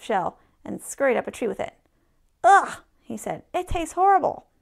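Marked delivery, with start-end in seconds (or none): 2.4-2.8 high, loud exclamation of disgust; loud, high yuck noise; disgusted ugh. A narrator's reading. Squirrel tastes horrible shell. 3.5-4.5 high, loud complaint of disgust; loud, high-pitched storybook disgust. A narrator's reading. Squirrel disgusted by shell taste.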